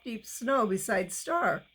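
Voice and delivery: ordinary delivery, nothing marked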